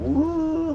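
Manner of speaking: high-pitched drawn-out woo; whooping "woo". Acted. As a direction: let out a long, high whoop. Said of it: "my foreign policy goes fucking".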